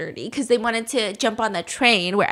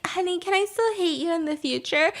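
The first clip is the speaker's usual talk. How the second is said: softer, sugary put-on sweet voice; talking weird; 4 semitones higher, 7 dB quieter than usual. Wife asking husband sweetly, softer put-on coo. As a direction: coo it oddly at him. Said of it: "You're talking weird".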